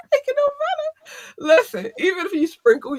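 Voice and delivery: high, cracking with laughter; words squeezed out between laughs; laughing through words. Real, not acted. Vlogger can't finish the line for laughing, high giggling. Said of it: "I couldn't get that out".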